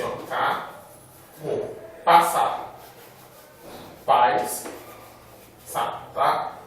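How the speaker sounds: ro, passar, paz, sa, sílabas bem lentas e destacadas; separando sílabas. Shown as a demonstration. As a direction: mostre a separação ro, paz, sa devagar. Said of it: "você vai fazer assim". (separação silábica lenta e marcada)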